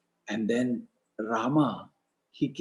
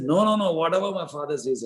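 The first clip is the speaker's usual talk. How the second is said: eager protest, voice raised; emphatic assurance; 5 semitones higher than usual. Retold or imitated. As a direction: cut in firmly to pledge obedience. Rama protests his obedience.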